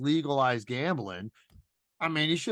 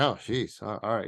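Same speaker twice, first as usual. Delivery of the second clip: lower, hesitant mock retreat; retold awkward backing off; 4 semitones lower than usual. Podcast host reacting to Bill Burr's refusal, retold awkward retreat. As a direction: back off with a mild, let-down "oh, jeez". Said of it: "What do you mean, oh, jeez?".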